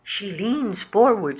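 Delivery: ordinary delivery, nothing marked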